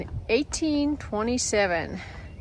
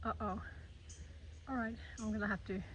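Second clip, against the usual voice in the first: quick, hushed and nervous; tense whisper; 18 dB quieter, faster than usual. Real, not acted. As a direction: whisper fast while backing away. Bear close, whispering.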